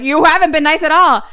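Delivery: high, loud protest; indignant protest. Acted. Boy contradicting babysitter, indignant yell. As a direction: fire back in outrage. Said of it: "And Jacob's like".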